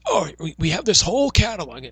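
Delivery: high, loud put-on salesman voice; strained mock sales pitch; John Laurinaitis. Salesperson showing off the trade catalog, hammed-up impression. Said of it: "that's gonna screw up my voice".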